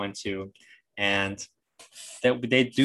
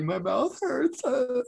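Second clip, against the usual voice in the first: put-on very high, slow whimper of a little boy; imitating hurt kid; much slower than usual. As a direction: squeak it out like a child in pain. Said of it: "He's like".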